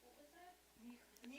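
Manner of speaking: soft unvoiced whisper. Real, not acted. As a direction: whisper the question to yourself. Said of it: "whispering to herself".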